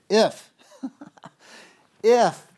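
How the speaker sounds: "If" punched high and loud; emphatic stress. Shown as a demonstration. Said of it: "I'm gonna emphasize a couple words here".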